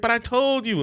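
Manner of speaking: high whiny server; mock server. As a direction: whine it like the server. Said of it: "She's like".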